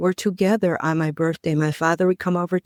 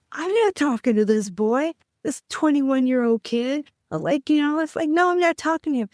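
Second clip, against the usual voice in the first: incredulous refusal; 9 semitones higher than usual. Retold or imitated. Refusing the boy on phone.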